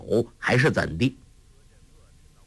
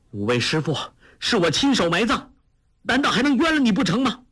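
climbing higher and faster in outrage; rising indignant outburst; 12 semitones higher, faster than usual. A narrator's reading. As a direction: build to an indignant, rushed cry. Accusing his teachers' killer. (越说越急的愤慨质问)

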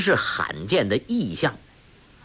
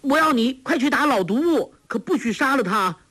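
put-on girl calling out; 12 semitones higher than usual. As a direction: tease the old man from across the fog. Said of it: "黄蓉叫道".

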